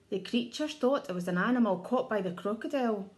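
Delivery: plain reading voice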